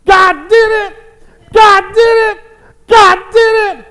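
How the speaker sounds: top-of-the-voice jubilant yell, very high; acted ecstatic cry of praise; excited shouting. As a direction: shout it out, overjoyed. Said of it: "shouting at the top of her voice".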